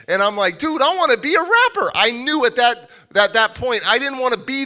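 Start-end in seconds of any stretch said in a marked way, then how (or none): 0.6-1.9 high, loud, thrilled plea; excited insistence. Retold or imitated. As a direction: reenact insisting on your new dream. Retells insisting to doubting friend.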